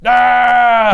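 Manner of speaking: loud, high mock scream; Wolverine. Wolverine screaming in the big fight, mock roar. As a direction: let out a hammed-up battle scream. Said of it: "Wolverine just screams".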